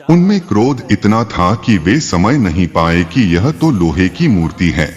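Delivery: plain reading voice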